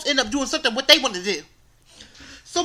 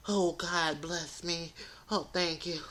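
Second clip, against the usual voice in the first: relieved thanks; 8 semitones lower, 12 dB quieter, slower than usual. Retold or imitated. Finally reaching the college.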